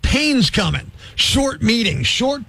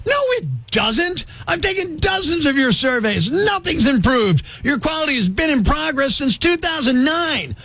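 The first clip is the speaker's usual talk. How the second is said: angry rant; 4 semitones higher than usual. Real, not acted. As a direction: rage at the corporations, building up. Radio rant, building to shouts.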